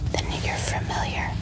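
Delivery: ordinary delivery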